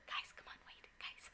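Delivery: whispered plea. Retold or imitated. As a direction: whisper to the family who won't hear. Ghost son voiced, ignored.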